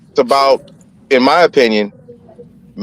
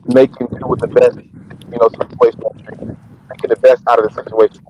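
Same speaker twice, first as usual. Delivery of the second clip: underwater. Real, not acted. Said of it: "you sound like you're underwater right now".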